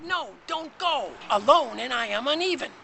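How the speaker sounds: high, loud pleading. Acted. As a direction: beg him not to go. Even pleads he stay.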